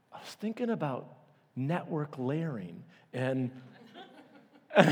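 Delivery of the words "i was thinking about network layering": dead serious. Retold or imitated. The nerdy friend answering, dead serious and soft. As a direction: say it totally earnest and quiet. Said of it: "dead serious".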